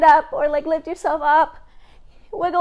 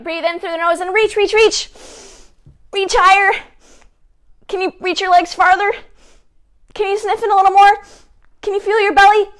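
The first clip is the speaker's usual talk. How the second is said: urging on; 6 semitones higher, 5 dB louder than usual. Real, not acted. Urging kids through a deep inhale.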